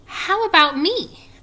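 high, slow, loud little plea; third spider's voice. A narrator's reading. Third baby spider wants a name too, high and piping. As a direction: pipe up so as not to be left out. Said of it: "asked the third spider".

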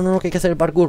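ordinary talk

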